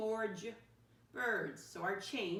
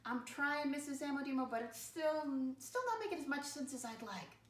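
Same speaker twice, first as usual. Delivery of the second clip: put-on higher, earnest kid voice for a pupil who's stuck; be the child still trying, pitched higher; struggling student; 7 semitones higher, 3 dB quieter than usual. Teacher voices a thumbs-down pupil, higher earnest child voice. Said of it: "Thumbs down".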